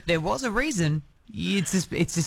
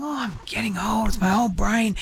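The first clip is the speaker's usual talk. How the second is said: imitated grumble at oneself, slightly raised pitch; mock self-berating; 4 semitones higher than usual. Host imitates dad berating himself over forgetfulness, mock self-reproach. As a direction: lightly act out someone scolding himself. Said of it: "hear him really berate himself".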